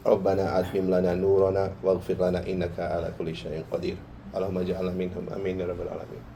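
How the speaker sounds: low, rapid, measured Arabic recitation. Acted. Khatib opening a khutbah with verse and prayer, low quick recitation.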